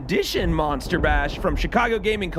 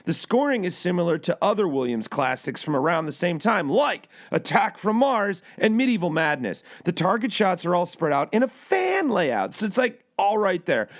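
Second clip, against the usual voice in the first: ordinary talk, nothing marked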